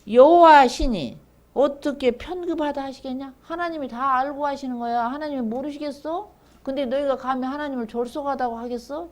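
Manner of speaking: high, challenging rhetorical questions; loud opening, sustained high indignant preaching; acted rebuke. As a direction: scold the congregation in the prophet's place. Acted scriptural rebuke of the people.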